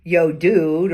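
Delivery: loud casual "Yo, dude"; breezy, loud slangy call; informal greeting. Shown as a demonstration. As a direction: hail a buddy across the hall. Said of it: "instead of saying".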